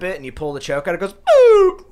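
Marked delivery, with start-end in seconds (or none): 1.1-1.8 squeaky electronic boop; high boop. Retold or imitated. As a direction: chirp it like a toy. Imitating the bong sound.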